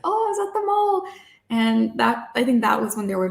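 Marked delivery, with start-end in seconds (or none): 0.0-1.0 very high, small-voiced reply; sheepish retold answer. Daughter admitting mall trip to parents, high-pitched innocent reply. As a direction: answer in a high, drawn-level voice.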